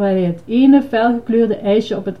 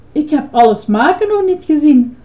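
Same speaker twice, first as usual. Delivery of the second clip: high, louder protest; calling out; 7 semitones higher, 5 dB louder than usual. A narrator's reading. Boy answers the ice-cream man, calling out. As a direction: call it out insistently. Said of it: "riep Herbie".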